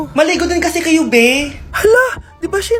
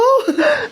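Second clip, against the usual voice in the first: a burst of delighted laughter; short delighted laugh; happy laugh; 8 semitones higher than usual. Acted. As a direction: laugh with joy. Joy at top-of-class news.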